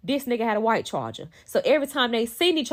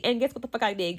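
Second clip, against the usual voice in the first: ordinary talk, nothing marked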